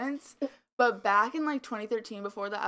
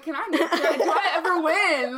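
exasperated, laughing, louder and higher; laughing protest; 7 semitones higher, 10 dB louder than usual. Retold or imitated. Retelling her reply to Kyle, laughing loud and exasperated. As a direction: plead through laughter.

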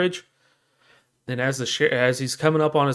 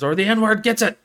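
raised, higher hostage-taker voice; mock threat; 10 semitones higher, 4 dB louder than usual. Sheriff threatening himself before the crowd, mock threat.

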